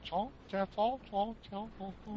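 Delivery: high mock gibberish chatter; garbled phone noise. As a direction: imitate garbled voice on the line. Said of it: "all I can hear is like".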